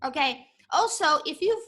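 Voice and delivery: ordinary talk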